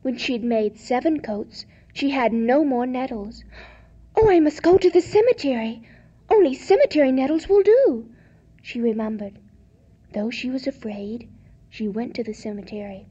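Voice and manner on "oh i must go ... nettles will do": higher, fast, worried voice; anxious. Acted. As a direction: rush it out in alarm. Lisa anxious inner thought.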